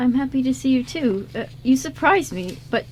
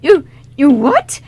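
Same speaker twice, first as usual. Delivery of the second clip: louder, stammering in disbelief; can't get the words out, shocked; stunned stammer; 9 dB louder, slower than usual. Acted. Liam hears Abigail knows the singer, loud stunned stammer.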